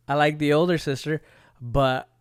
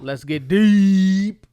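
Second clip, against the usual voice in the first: show catchphrase; 6 semitones higher, 7 dB louder, much slower than usual. Acted.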